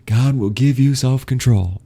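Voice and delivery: mildly acted church-goer. Mimicking church teaching, raised pitch.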